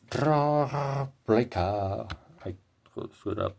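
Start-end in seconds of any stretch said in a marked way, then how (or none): 0.1-2.1 high, drawn-out metal singer's delivery; very slow, high-pitched imitation of a singer's phrasing. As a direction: stretch out both halves like a metal vocalist. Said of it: "The way that [name] says".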